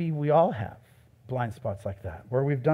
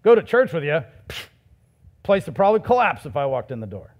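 bitter outsider scoff; 5 dB louder than usual. Retold or imitated. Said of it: "it sounds something like this".

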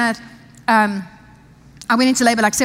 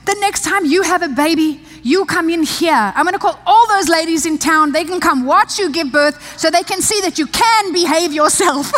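nurse praising; 6 semitones higher than usual. Retold or imitated. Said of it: "she's like".

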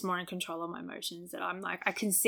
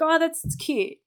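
high-pitched token approval; lightly mocking, pitched-up politeness; imitating partner's reaction; 8 semitones higher, 4 dB louder than usual. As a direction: mimic a partner feigning interest. Mimicking partner's lukewarm reaction.

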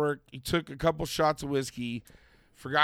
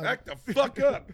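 berserk rage; 10 semitones higher than usual. Acted. Mock threatening parent.

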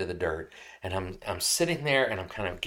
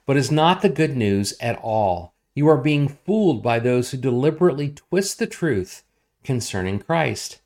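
loud, raised, emphatic proclamation; forceful scripture reading; 4 semitones higher, 8 dB louder than usual. Acted. Preacher reading Paul's rebuke to the Galatians, forceful. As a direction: declaim it to the whole room.

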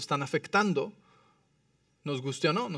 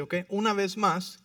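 normal talking voice

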